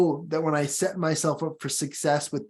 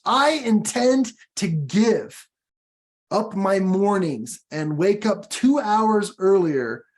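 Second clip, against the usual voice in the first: "I intend to give up my mornings" slow, higher and louder; emphasize the second part; 4 semitones higher, 3 dB louder, slower than usual. Shown as a demonstration. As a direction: stretch out "I intend to give", every word weighted. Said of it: "I emphasize the second part".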